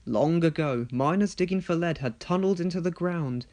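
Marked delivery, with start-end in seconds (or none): none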